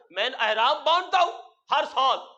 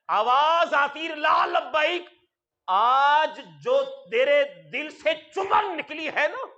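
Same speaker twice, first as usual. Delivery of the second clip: booming divine voice. Acted. Preacher voicing the voice from heaven, booming. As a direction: proclaim it like heaven answering. God's voice in sermon story.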